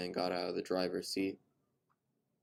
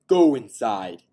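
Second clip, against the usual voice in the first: much louder sharp bark; barked order; 4 semitones higher, 18 dB louder, slower than usual. A narrator's reading. Father ordering his daughter inside, angry bark. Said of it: "he barked at her".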